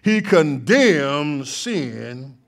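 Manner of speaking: rising pulpit fervor, loud and ringing; full-force preaching. Acted. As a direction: lift the church, proclaim it.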